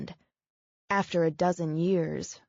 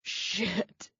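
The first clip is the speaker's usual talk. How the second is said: strained gasp; 5 dB quieter than usual. Acted. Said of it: "he managed".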